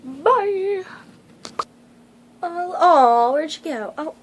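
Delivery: high loud cooing; excited cooing. Real, not acted. Owner finding her pet asleep, loud cooing. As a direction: coo over the sleeping animal. Fussing over sleeping pet.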